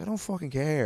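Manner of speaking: high-pitched, indignant retort; heated re-enacted comeback; retold exasperation. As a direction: act out losing patience with a heckler. Retelling a row with audience member.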